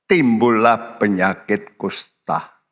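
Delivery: slow emphatic repeat. Acted. Preacher repeats verse for emphasis.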